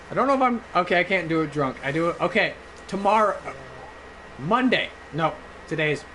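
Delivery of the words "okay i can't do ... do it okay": ordinary talk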